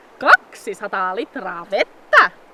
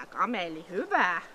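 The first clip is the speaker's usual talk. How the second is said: ordinary talk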